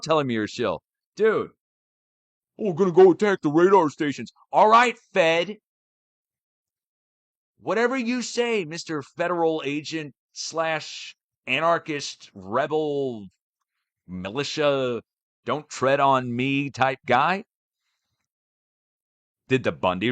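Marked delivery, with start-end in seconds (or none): 2.6-4.3 high, loud mock-dumb militia voice; sneering impression of a would-be rebel; mocking militia quote. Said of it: "mocking the militia's words". 4.5-5.6 sarcastic. Real, not acted. Sarcastic jab at agent. 7.6-17.4 sarcastic. Real, not acted. Sarcastic list of labels.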